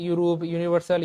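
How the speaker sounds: normal talking voice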